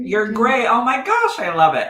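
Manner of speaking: high, loud gushing delight; excited. Real, not acted. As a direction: burst out thrilled for a friend. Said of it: "I'm so excited".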